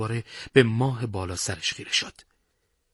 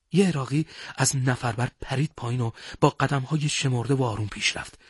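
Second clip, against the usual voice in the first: plain reading voice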